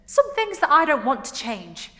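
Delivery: loud, high impassioned protest; acted outburst, defending who you are; very emotional. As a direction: plead your case at full voice. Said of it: "very emotional because she hasn't come out".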